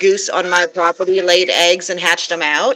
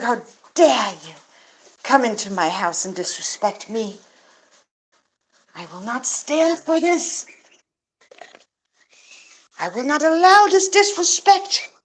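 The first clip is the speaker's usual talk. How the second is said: indignant gander voice; 4 semitones higher than usual. Retold or imitated. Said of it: "He's like".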